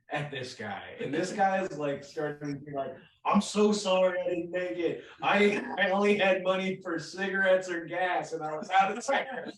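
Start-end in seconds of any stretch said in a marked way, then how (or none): 3.3-9.5 higher, pleading mock apology; hammed-up sorry guy; apologetic excuse-maker. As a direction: plead your excuses like a scolded man. Retold man apologizing to angry woman.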